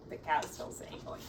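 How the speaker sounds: ordinary delivery, nothing marked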